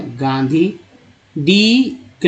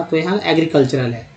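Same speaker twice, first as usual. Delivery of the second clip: ordinary delivery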